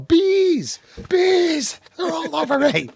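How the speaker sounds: high, loud panicked shout; panicked yelling. Pretend. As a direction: scream it like bees attack. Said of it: "you hop out and you yell".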